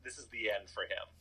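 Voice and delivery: ordinary delivery, nothing marked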